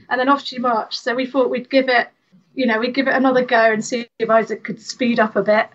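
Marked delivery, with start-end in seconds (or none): none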